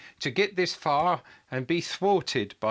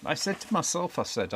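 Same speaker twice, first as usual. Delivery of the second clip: ordinary talk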